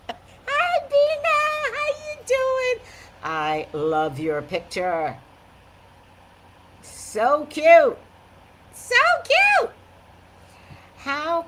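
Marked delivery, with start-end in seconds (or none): 0.1-2.9 much higher squeaky cutesy hello; cutesy greeting. Pretend. Greeting viewer Bina in a cutesy squeak. 6.8-8.0 gushing. Real, not acted. Gushing over picture. 8.7-9.7 much higher squeal of delight; squealing gush. Real, not acted. Squealing to Bina about her photo. Gushing over picture, squeaky.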